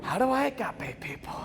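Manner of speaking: hushed doubt. Retold or imitated. Pumped student suddenly unsure how to start, hushed doubt. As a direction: drop the bravado and wonder quietly.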